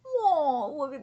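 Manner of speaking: high, loud whoa; excited whoa. Real, not acted. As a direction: blurt it out in surprise. Reacting to dragons.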